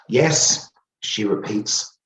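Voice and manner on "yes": lightly voiced old woman, higher and louder; a firm, emphatic yes; insistent shop owner. A narrator's reading. Shop owner confirming her gossip to the narrator, insistent.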